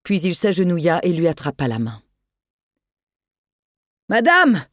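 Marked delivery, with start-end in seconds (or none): 4.1-4.6 loud, higher call over the storm; shouting. Acted. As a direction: call out to reach her through the noise. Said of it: "cria-t-il pour couvrir le fracas ambiant".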